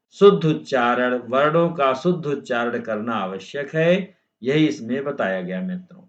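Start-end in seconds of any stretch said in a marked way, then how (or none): none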